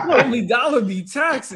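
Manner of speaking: loud, laughing complaint; laughing gripe. Real, not acted. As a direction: crack up while griping about prices.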